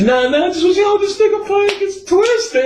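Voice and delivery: exaggerated high teen whine; mocking son's voice. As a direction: mimic the kid sweet-talking at the door. Son's voice, mocked at the door.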